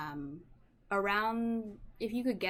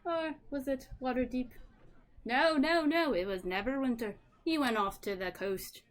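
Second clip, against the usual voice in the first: put-on Irish accent; 5 semitones higher, 6 dB louder than usual. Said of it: "an Irish accent".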